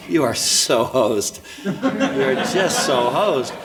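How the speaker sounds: laughing through the words, slow. Real, not acted. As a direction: chuckle out the verdict, drawn out. Laughing at parent's admin plight.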